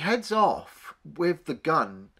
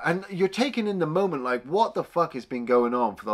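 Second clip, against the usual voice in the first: ordinary talk, nothing marked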